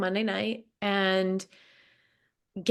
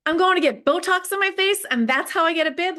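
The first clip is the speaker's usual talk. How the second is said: much higher and louder, amazed disbelief; can you believe it, pitched way up; incredulous; 10 semitones higher, 6 dB louder than usual. Real, not acted. Disbelief retelling the bib luck.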